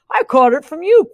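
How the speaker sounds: loud, slight hint of quacking dog; lightly quacked accusation. A narrator's reading. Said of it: "quacked the dog".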